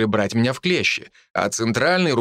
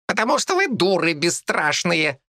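squeaky rat voice; 7 semitones higher than usual. A narrator's reading. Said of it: "пропищала Лариса".

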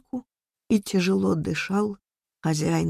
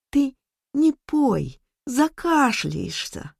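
put-on pleading girl; 6 semitones higher, slower than usual. A narrator's reading. Frightened girl pleads with grandfather.

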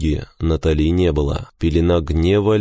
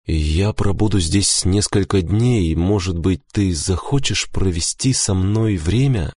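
usual speaking voice

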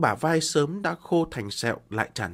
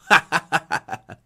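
loud bitter laugh; 3 semitones higher, 6 dB louder than usual. Acted. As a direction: laugh with despair. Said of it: "cười nói".

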